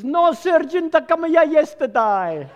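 put-on shouted reply in a Portuguese accent; high, loud Portuguese-accented punchline, last word drawn out; Portuguese recruit. Portuguese recruit answers his sergeant, earnest accented shout. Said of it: "the Portuguese guy says".

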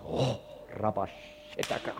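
normal talking voice

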